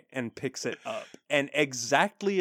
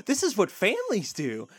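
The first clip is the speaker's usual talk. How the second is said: higher, awed gushing; delighted pony; 7 semitones higher than usual. Retold or imitated. Pony thrilled by a family quarrel, gushing. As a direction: marvel at it, pitched up.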